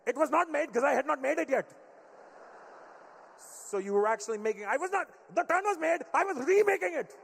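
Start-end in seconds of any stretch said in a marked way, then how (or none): 0.1-1.7 louder, higher indignant Indian dad; louder indignant Indian-dad rebuttal. Retold or imitated. Dad voiced, escalating argument. 4.6-7.2 much higher, louder Indian-dad outburst; high loud furious Indian-dad protest; indignant dad shout. Retold or imitated. Dad voiced, escalating argument.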